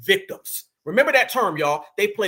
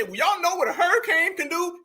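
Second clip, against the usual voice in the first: mock questioning; 7 semitones higher than usual. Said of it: "Is she sitting there trying to say".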